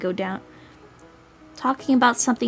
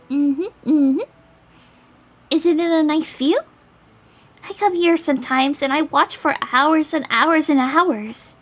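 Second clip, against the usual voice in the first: put-on higher-pitched girl, slow and lilting; lilting, raised girlish voice; dreamy dandelion girl; 6 semitones higher, 3 dB louder than usual. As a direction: play a girl marvelling at the view.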